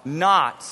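a call for the church to say "not" together; stress on not. Shown as a demonstration. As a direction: land hard on "not". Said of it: "say that word with me".

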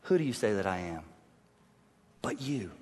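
low, soft, searching question; hushed solemn question. Acted. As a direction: ask it quietly, eye to eye. Voicing Jesus, hushed.

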